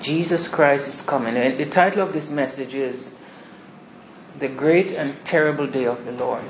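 normal talking voice

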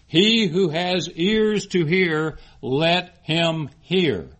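slow, loud, weighted word by word; emphatic scripture quote. Acted. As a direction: proclaim it slowly, leaning on each word. Preacher solemnly declaims Jesus' words.

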